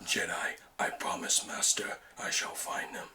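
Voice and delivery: low unvoiced menacing whisper; whispered Sith villain; Darth Maul impression. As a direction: hiss it in a whisper like a Sith lord. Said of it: "Darth Maul impression?".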